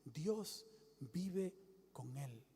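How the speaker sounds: preacher voicing the heavenly voice, slow and soft; hushed heavenly voice. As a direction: breathe it out slowly, almost secret. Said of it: "Oí una voz que decía".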